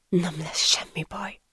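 slow, soft comforting whisper; whispered reassurance. A narrator's reading. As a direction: soothe her gently under your breath. Friend comforts crying woman. (lassú, vigasztaló suttogás)

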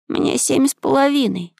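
put-on high-pitched boy musing; high boyish reflection; boy thinking aloud. A narrator's reading. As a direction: say it to yourself in a high young voice. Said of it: "сказал про себя Максим".

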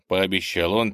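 plain reading voice

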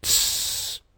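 soft, long "tsss"; hissed shush. A narrator's reading. Fox silences the bear, hissing a shush. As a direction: hush him with a sharp hiss. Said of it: "зашипела раздражённая лисица".

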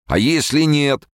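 sharp, drawn-out alarmed question; exclaiming. A narrator's reading. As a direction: blurt it out in alarm. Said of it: "воскликнул Хрущев".

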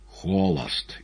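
ordinary delivery

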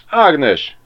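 loud, high call to someone off; hollering. A narrator's reading. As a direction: holler the name out. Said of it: "kurjantott Ribiszke". (nagyot kurjantva)

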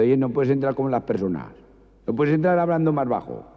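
riñe al cliente que grita; riña del estanquero. Acted. Estanquero riñendo al maño. (estanquero regañando al maño)

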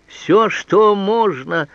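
loud, high, very slow echo; возмущённое восклицание. A narrator's reading. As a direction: exclaim it word by word, stretched out. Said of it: "снова воскликнул он". (громкое протяжное восклицание высоким голосом)

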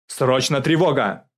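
loud military command; ordering. A narrator's reading. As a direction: order the alarm with authority. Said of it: "приказал генерал". (громко приказать)

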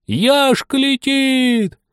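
high drawn-out shout; excited crowd yell. Acted. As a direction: call out as he flies off. Crowd yells as lizard flies.

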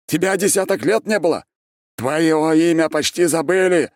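high, loud indignant ranting; hysterical tirade. A narrator's reading. Mad ex-emperor confronts the returned archmage, ranting. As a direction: pour out grievances at a feverish pitch.

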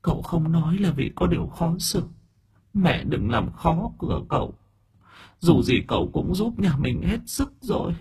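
a lost spirit speaking gently to her parents; a girl's faint ghost voice, high and soft; dead daughter's voice. A narrator's reading. Ghost daughter calms mother.

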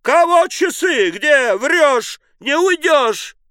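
very high, loud screeching cries; frantic high-pitched yelling; terrible howl. A narrator's reading. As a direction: howl in outrage. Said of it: "она взвыла страшным голосом".

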